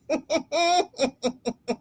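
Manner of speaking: high chuckling laugh; real amused laughter. Real, not acted. Laughing at viewer's chat comment.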